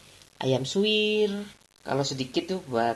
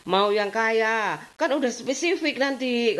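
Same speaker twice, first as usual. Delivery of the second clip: teasing own remark; 8 semitones higher, slower than usual. Retold or imitated. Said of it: "kayak gitu aku".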